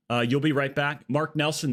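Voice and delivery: ordinary talk, nothing marked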